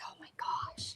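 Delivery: barely audible, whispered disbelief; whispered exasperation. Retold or imitated. Recalling the anger incident at work, whispered exasperation. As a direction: whisper it to yourself, no voice at all.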